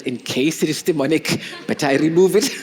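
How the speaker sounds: laughing while confessing, half joking. Retold or imitated. Wife laughs recalling her fear before driving her husband. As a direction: giggle through the thought.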